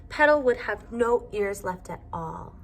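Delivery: usual speaking voice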